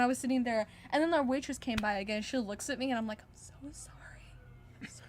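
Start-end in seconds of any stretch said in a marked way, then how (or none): 3.2-5.0 soft unvoiced sorry; whispered apology. Teen apologizing to waitress after mom's scene, whispered.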